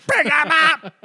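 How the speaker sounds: high, blaring yell; loud, high-pitched shout; shouted catchphrase. Pretend. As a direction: holler the call at full volume. Shouted catchphrase for a fantasy pick.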